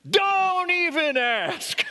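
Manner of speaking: high, slow, exasperated cashier voice; grumpy cashier. Retold or imitated. Cashier answering the pastor's question in a high, mock-weary voice. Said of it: "this was a quote of hers".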